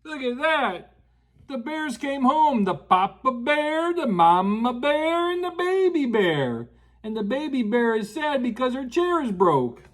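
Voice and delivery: usual speaking voice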